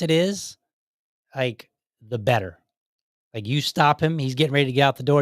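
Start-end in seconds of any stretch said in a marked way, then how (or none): none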